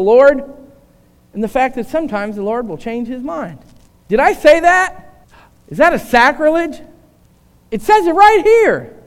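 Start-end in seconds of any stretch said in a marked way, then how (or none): none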